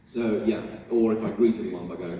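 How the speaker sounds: ordinary talk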